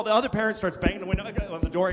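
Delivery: usual speaking voice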